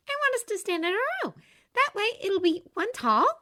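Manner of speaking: put-on high, bright girl's voice; bubbly young woman; cheerful young woman voice. A narrator's reading.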